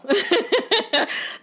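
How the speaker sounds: high bursting laugh. Real, not acted. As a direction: laugh out loud at yourself. Laughs at own joke.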